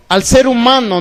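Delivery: ordinary delivery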